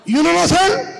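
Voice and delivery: high, rising Pakistani clerk question; Pakistani accent. Acted. Clerk quizzing the Russian lady, Pakistani accent. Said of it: "That means".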